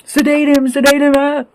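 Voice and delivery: high, panicked mock cries; hammed-up frantic plea from a hospital bed; mock freaking out. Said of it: "Starts freaking out".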